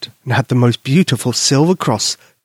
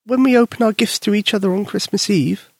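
put-on little girl voice; 8 semitones higher than usual. Susie explains sadly.

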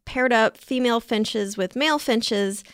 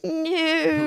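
put-on German accent; 7 semitones higher than usual. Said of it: "Your German accent is spot on".

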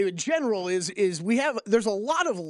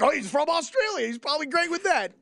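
mock pundit; 7 semitones higher than usual. Mocking pundit assumption.

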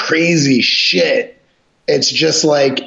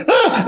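short, high mock gasp; startled yelp. Storyteller imitating the man dropping his knife, high startled yelp. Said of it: "he just goes".

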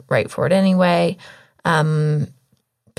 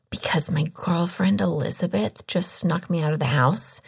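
whispering; 6 dB quieter than usual. Real, not acted. Secret outing, voice going.

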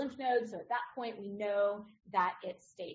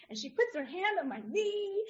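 usual speaking voice

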